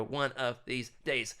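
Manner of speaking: each word drawn out deliberately; slow, emphatic repeat. Real, not acted. Host vowing to book an author someday, slow and emphatic. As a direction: stretch out ONE OF THESE DAYS word by word.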